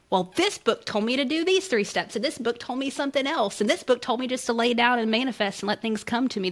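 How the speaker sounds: mock the reader who is drowning in advice; overwhelmed reader.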